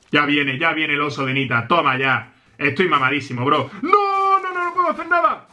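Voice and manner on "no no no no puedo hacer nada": high-pitched panicked protest; high, desperate gamer cry; helpless panic. Real, not acted. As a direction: squeal it out as all is lost.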